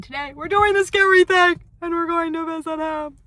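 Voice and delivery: high, loud burst of nervous energy; real jittery brave announcement; nervous excitement. Real, not acted. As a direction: psych yourself up for the scary thing. Dreading the visit.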